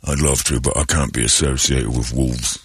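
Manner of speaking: deadpan low Crowe impression; low mock Russell Crowe.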